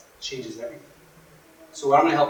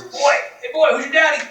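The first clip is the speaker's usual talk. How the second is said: high, louder sing-song taunt; mock the boy from the porch; jeering old codgers; 11 semitones higher, 5 dB louder than usual. Old codgers jeering at the fatherless boy, high and taunting.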